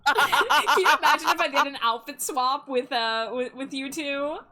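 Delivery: loud, laughing through the words; bursting with a giddy idea; laughing excitedly. Real, not acted.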